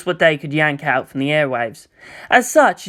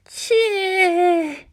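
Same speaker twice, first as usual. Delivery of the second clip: high, skeptical little sound; a doubting high voice; Jamyang scoffing. Acted.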